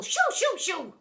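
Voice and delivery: high, loud shoo-ing. A narrator's reading. Deputy chasing everyone out, loud high shoos. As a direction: shoo them out like hens.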